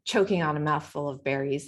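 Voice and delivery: plain reading voice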